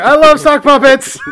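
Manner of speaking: high, loud mock of his line; squeaky teasing echo; mocking imitation. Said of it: "I should just cut the episode right there".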